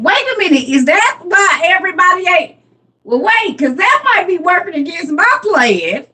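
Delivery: high, surprised double take; sudden realization. Real, not acted. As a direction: catch yourself mid-thought, pitch way up. Sudden realization about her dad.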